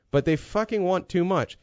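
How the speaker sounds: usual speaking voice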